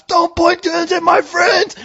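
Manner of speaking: high, drawn-out retold yell; strained high yell to protect friends; screaming at cops. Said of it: "I was screaming at the cops".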